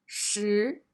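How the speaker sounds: 实 with a rising second tone; 实 said shí, rising. Shown as a demonstration. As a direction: lift 实 upward. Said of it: "The second character is the second tone".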